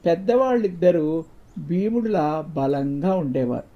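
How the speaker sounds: plain reading voice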